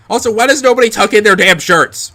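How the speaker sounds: loud, high-pitched exasperated complaint; mock outrage. Real, not acted. Reader complaining about the characters' outfits, mock outrage. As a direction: raise your voice in comic indignation.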